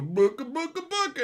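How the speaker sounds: high clucking booka calls; chicken noises. Retold or imitated. Trolls in chat, mock chicken clucking. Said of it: "make chicken noises".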